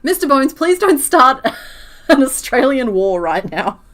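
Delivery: loud, high, half-laughing plea; hammed-up overwhelmed protest; mock pleading. Mock plea to a chat member.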